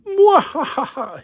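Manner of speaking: slow high evil cackle; mad scientist laugh. Pretend. Evil laugh holding the figure.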